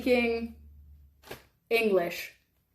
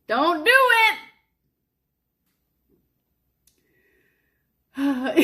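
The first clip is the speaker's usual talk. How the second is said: louder warning; 4 semitones higher, 4 dB louder than usual. Real, not acted.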